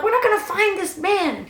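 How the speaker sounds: high, fed-up retort; high exasperated. Retold or imitated. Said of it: "I'm like".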